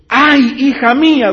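loud, high grieving cry; anguished cry. Acted. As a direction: cry out to the daughter in grief. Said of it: "rompió su vestido diciendo". (Jefté grita con dolor a su hija)

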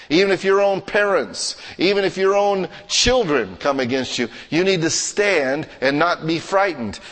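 high-pitched, insistent sermon delivery; forceful preaching. Real, not acted. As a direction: press each phrase home to the congregation.

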